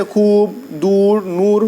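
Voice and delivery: "خوب، دور، نور" each with a stretched oo; drawn-out long u. Shown as a demonstration. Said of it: "جو کھینچ کر پڑھا جائے".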